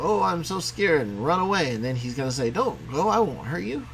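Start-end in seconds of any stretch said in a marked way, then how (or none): 0.0-1.1 higher, slow, hammed-up fright; mock scared alien. Teacher voicing the alien fleeing the cat, mock fright. 2.5-3.9 higher, coaxing cartoon cat. Teacher voicing the cat calling after the alien, coaxing.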